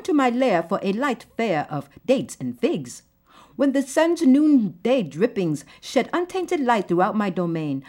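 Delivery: poem read in a put-on Arabic accent. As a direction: perform the verse with an Arabic accent. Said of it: "it's not meant to offend anyone who may have this kind of accent".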